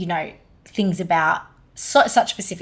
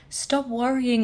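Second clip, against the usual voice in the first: higher-pitched hint of an Italian accent; 5 semitones higher, slower than usual. Recalling her late grandfather's words to her, light Italian accent. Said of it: "in his Italian, Italian accent".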